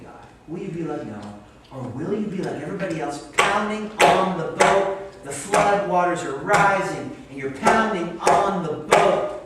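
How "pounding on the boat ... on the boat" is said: loud preaching climax. Acted. Preaching climax about flood.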